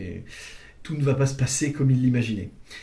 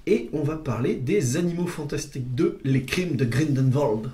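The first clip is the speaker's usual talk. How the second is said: lightly German-accented French title. Shown as a demonstration. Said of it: "j'ai pris un accent allemand". (accent allemand léger)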